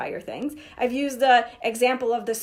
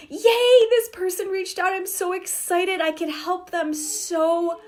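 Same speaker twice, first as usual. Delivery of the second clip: squealed yay, much higher and louder, then slowing; mock thrilled; 7 semitones higher, 3 dB louder, slower than usual. Coach acts a seller overjoyed to help, bubbly and high. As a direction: gush with delight over a new client.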